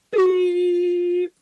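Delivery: high mouth-made beep sound; high electronic beep done by mouth; imitated warning beep. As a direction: mimic the dashboard alarm with a high beep.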